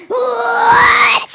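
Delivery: high, loud distorted bellow; monstrous shout. Retold or imitated. Hallucinated chicken mask roaring down the hallway, loud monstrous shout. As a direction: roar it like a monster flying at you.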